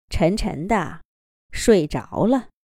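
usual speaking voice

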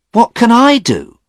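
high, loud pleading question; bewildered young man; helpless young man. Acted. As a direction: protest he can't help. Stephen voiced, helpless.

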